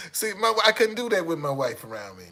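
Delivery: usual speaking voice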